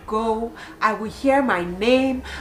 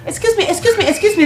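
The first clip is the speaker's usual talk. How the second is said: much higher, louder insistent calling; 11 semitones higher, 5 dB louder, faster than usual. Retold or imitated. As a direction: call out insistently, pitched up. Man calling again, insisting.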